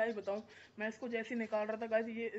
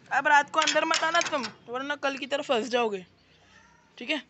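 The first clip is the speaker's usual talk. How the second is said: higher, louder mock telling-off; scolding a puppy; 4 semitones higher, 12 dB louder than usual. Real, not acted. Vlogger warning a puppy not to come in at night, playful scolding. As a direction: wag a finger at the pup with your voice.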